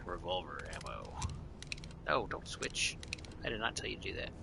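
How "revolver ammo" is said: normal talking voice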